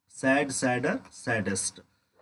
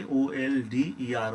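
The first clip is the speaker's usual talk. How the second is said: letters spelt one by one, slowly; slow letter-by-letter dictation; slow spelling out; much slower than usual. Shown as a demonstration. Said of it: "old को क्या बनाएंगे".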